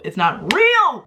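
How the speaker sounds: REAL drawn out high and loud; emphatic insistence. Real, not acted. Insists loudly her online world is fake.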